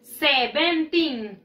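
"Seventeen" slowly, syllable by syllable; slow, stretched "Seventeen" with every syllable clear; demonstrated pronunciation. Shown as a demonstration. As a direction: model "Seventeen" for learners, drawn out to a long teen. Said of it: "se pronuncia así".

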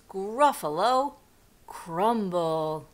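deliberate, ominous mouse boast; menacing announcement. Acted. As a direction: spell out the threat word by word. Mouse threatens the gruffalo.

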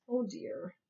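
narrator's quiet dismay; soft rueful aside. A narrator's reading. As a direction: murmur it like a sorry onlooker.

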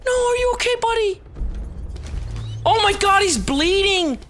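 very high, worried cry; distressed alarm. Real, not acted. Player checking on the bleeding dog, distressed.

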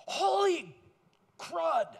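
high, loud excited holler; excited exclaim. Retold or imitated. Golfer running at them, high excited holler. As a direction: blurt it out in disbelief.

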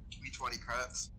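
usual speaking voice

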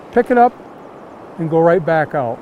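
usual speaking voice

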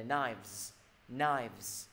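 KNIVES as "nives" with buzzing v-z; model pronunciation. Shown as a demonstration. Teacher modelling a hard sound, KNIVES with clear v-z ending. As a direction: stretch KNIVES, keeping k silent and ending voiced. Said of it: "Âm này là âm thật ra nó khó".

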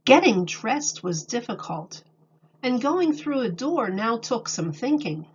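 calm storybook reading voice; reading to children. A narrator's reading. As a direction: read it to a class of little kids. Reads picture book aloud.

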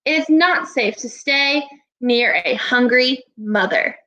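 ordinary talk, nothing marked

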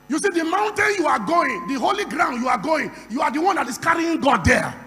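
shouted, rousing sermon climbing to a roar; full-force preaching. Real, not acted. Preacher telling the congregation they carry God, roaring declaration. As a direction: preach it at the top of your voice.

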